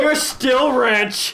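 drawn-out shout. Real, not acted. Joking at bloody friend.

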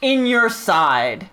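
high, slow, strained narration of pain; dramatic narration. Acted. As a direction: draw out the agony, slow and high.

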